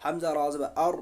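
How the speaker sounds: ordinary talk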